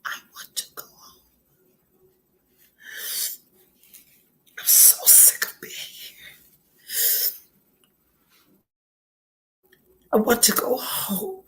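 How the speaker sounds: barely voiced tearful whisper; crying, homesick. Real, not acted. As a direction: squeeze the words out through real tears. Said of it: "I'm gonna cry again".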